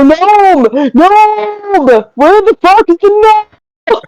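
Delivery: mock yell for someone missing; calling out. Searcher yelling for the missing gnome, high mock yell. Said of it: "I'm gonna yell".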